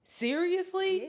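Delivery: high, loud, stretched question; incredulous. Real, not acted. Host questioning all that acting up, incredulous. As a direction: draw it out in rising disbelief. Exasperated question after retold reaction.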